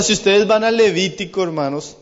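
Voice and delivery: usual speaking voice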